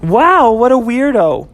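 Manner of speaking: scoff, pitched high; girlfriend Ashley. Acted. Girlfriend mocking the strange customer, high and dismissive.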